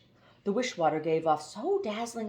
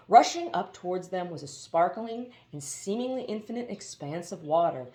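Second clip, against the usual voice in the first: ordinary delivery, nothing marked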